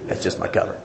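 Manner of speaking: hushed, conspiratorial aside; sly whisper. Retold or imitated. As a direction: whisper it like a secret confession. Same excuse repeated, whispered.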